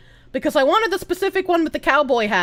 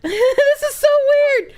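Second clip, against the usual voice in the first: laughing; 9 semitones higher, slower than usual. Real, not acted. Laughing at the stream.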